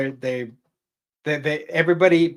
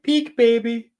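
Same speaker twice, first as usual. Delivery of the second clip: weird ethereal voice, very high; high mock-ghostly coo to a baby; Mother Abigail; 12 semitones higher, 6 dB louder, slower than usual. Ethereal apparition playing peekaboo with the baby, weird high coo. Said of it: "this weird ethereal Mother Abigail".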